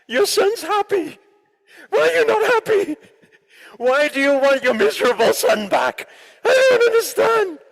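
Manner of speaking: very high, loud frantic pleading; exasperated pleading. Retold or imitated. Son begging his crying mother to understand, very high and frantic. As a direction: plead in total bafflement, voice cracking high.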